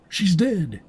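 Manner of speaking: high, loud cry of shock. Acted. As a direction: survivor reporting the overseer's death, crying out. NPC quoting the overseer's death.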